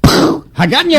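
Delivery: high, slow disbelieving repeat; incredulous. Real, not acted. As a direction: echo Hagatnya in high disbelief. Incredulous repeat of strange name.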